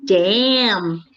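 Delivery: imitated American drawn-out "Damn"; attempted American accent. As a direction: have a go at American. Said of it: "I cannot do the American accent".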